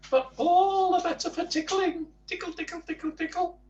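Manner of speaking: Mister Tickle cheerfully showing off his arms. Acted. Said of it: "said Mister Tickle cheerfully".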